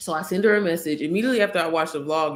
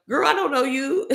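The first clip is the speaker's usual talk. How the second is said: put-on higher, sassy girl voice, waving a stranger off; sassy brush-off; 8 semitones higher than usual. To a stranger in her DMs, a sassy high brush-off. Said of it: "that's how I definitely would have been like".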